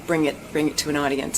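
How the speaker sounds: ordinary delivery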